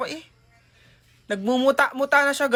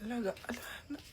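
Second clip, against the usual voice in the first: pained whisper; 18 dB quieter, faster than usual. Acted. Pained whisper over sore eyes.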